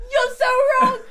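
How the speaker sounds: high, loud laughing yell; laughing shout. Retold or imitated. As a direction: shriek it through laughter. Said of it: "And everyone was like".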